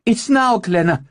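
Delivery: high, loud battle call; urgent shout. Acted. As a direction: yell it across the field. Battle cry to Glenna.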